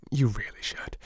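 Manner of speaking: a quick breathy whisper, voice dropped away; whispered aside. A narrator's reading. Holmes whispers his advice to Gregson.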